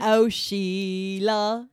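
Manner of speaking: drawn-out mock Australian accent. Co-host naming the next song for a friend who can't do accents, Australian accent. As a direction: stretch the words right out in a put-on Aussie accent. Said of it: "cannot do the correct accent".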